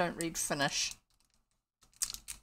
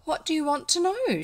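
high-pitched character voice; girl's question; 10 semitones higher than usual. A narrator's reading. Elfrida asks the gentlemen what they want, high questioning voice. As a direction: ask in a raised, girlish voice. Girl answers her questioners.

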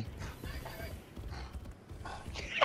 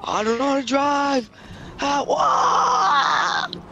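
hammed-up panic; 4 semitones higher, slower than usual. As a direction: panic rising into a high cry. Losing control of the car.

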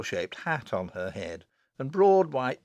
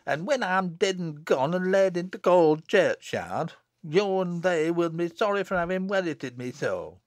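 high, raised, self-pitying complaint; peevish; 6 semitones higher, 4 dB louder than usual. A narrator's reading. Jennet reproaching sister and friends, peevish. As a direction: make them feel guilty, fretfully. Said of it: "rejoined Jennet peevishly".

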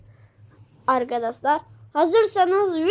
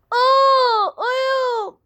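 mock pain; 7 semitones higher than usual. Character hurt in the foot.

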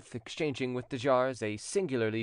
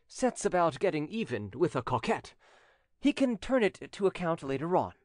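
lightly voiced gallant, higher and animated; a hint of a swaggering commander; boastful commander; 6 semitones higher than usual. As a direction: brag as a seasoned gallant.

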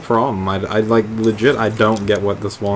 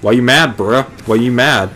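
louder, mocking why you mad; loud taunt; 3 semitones higher, 5 dB louder than usual. Real, not acted. Gamer taunts a fish attacking him, loud and mocking.

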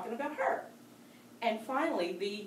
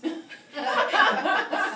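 laugh; 8 semitones higher, 7 dB louder than usual. Real, not acted. Laughs at the green mess.

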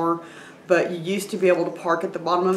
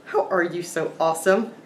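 normal talking voice

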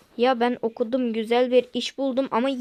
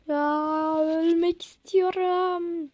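mock despair; 6 semitones higher, much slower than usual. Despair over work.

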